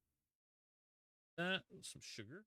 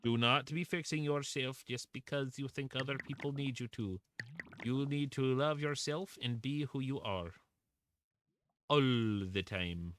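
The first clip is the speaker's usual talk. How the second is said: mock-earnest pep talk in a put-on, slightly higher voice; 5 semitones higher than usual. Streamer lecturing someone not to fix themselves, in a put-on voice. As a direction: put on a higher voice to preach self-love. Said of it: "I was using a voice".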